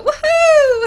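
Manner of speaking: loud, soaring falsetto whoop; piercing high-pitched cheer; high-pitched woohoo. Shown as a demonstration. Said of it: "You gotta have a high-pitched voice".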